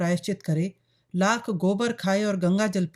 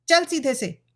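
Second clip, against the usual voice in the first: higher, commanding scold; harsh old woman; 6 semitones higher, 4 dB louder than usual. A narrator's reading. Old mother orders daughter to move, harsh. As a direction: order her along harshly, pitch raised. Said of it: "बुढ़िया कर्कश स्वर में बोली".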